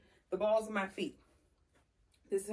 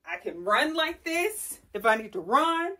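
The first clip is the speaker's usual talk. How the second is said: ordinary talk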